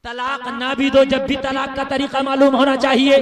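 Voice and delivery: loud, rhythmic chanting sermon on one pitch; full-force preaching. Real, not acted. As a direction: build the list into a ringing cry. (पूरे ज़ोर से लयबद्ध तक़रीर)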